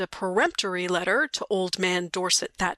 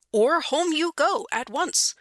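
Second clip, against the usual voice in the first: raised-pitch scolding; firm warning, pitched up; stern ultimatum; 7 semitones higher than usual. Acted. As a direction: lay down the law to a naughty child. Sam scolds the boy with ultimatum.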